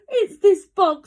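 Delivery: high, loud dog complaint; howling dog. A narrator's reading. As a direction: voice an upset dog. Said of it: "who was howling".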